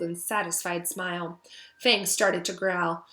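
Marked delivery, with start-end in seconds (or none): none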